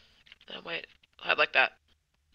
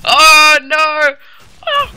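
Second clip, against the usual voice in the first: being chased; 6 semitones higher, 4 dB louder, slower than usual. Real, not acted. Chased again by seeker.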